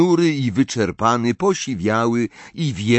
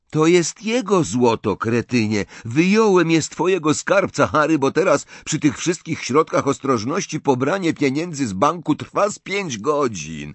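put-on higher, brisk young man's banter; lightly voiced Bill; 8 semitones higher than usual. Bill handing Harry his gold, teasing Ron lightly. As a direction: tease his brother, then reassure. Bill's voice held.

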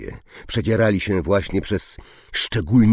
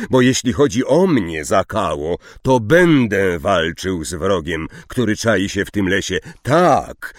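loud, higher, fierce defiance; furious outburst; 5 semitones higher, 5 dB louder than usual. Acted. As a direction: hurl it at him in fury. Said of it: "ryknął Firenzo". (Firenzo wściekle i głośno przeciwstawia się Zakale)

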